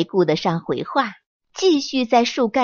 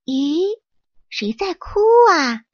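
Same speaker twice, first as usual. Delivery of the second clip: puzzled question; 7 semitones higher than usual. Acted. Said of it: "皮皮问道".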